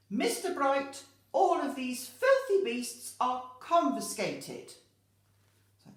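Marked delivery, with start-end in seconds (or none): 0.1-4.7 loud, high-pitched storybook outrage; bossy, indignant command; outraged headmistress. Acted. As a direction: lay down the law at full volume. Headmistress orders pets taken away.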